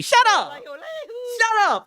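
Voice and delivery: squeaky high shut up; high-pitched shut up. Real, not acted. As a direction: yelp it up high, half laughing. Streamer reacting to chat mockery.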